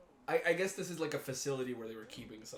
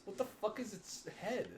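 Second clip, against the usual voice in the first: ordinary delivery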